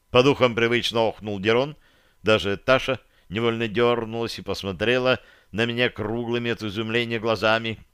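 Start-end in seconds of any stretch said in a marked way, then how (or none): none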